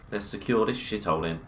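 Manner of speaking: normal talking voice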